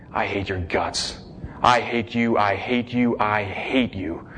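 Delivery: low, slow and quiet, each word pushed out coldly; cold hatred. Retold or imitated. Teenage son to his crying mother, low cold contempt. Teen snarls at crying mom.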